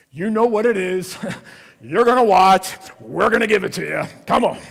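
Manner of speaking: loud, pushy ad pitch with a laugh; hammed-up marketer. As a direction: sell it like a cheesy promo. Mock Hallmark marketer pitch.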